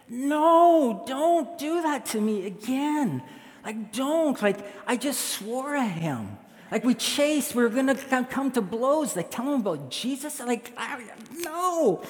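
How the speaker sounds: high, drawn-out whiny refusal; pleading protest. Acted. Pastor arguing with God's prompting, high pleading protest. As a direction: protest in a high, stretched wail.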